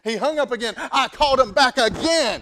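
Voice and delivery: loud, high indignant outburst; fired-up retelling. Retold or imitated. Preacher scolding customer service over the phone, fired up. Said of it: "I was so fired up".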